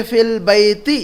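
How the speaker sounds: "फिल बैती" with short fil, L sounded; "फिल बैती" read with the article linked on; article added. Shown as a demonstration. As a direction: say "फिल बैती" as fil-bayti, joined by lam. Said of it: "अलिफ लाम लगा दिया उसमें तो उसको पढ़ेंगे".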